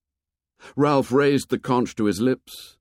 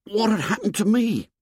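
put-on high, appalled boy's question; horrified boyish voice; 13 semitones higher than usual. A narrator's reading. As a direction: ask it in high-pitched dismay. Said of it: "said Piggy in an appalled whisper".

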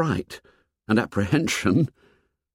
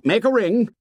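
high commanding call; high-pitched boyish shout; 11 semitones higher than usual. A narrator's reading. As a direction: call the order out to the group. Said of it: "Jack shouted".

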